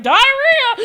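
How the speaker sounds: high, loud call of the word; loud repeat. Retold or imitated. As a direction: call it out again. Repeating warning to friend.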